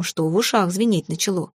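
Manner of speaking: ordinary delivery, nothing marked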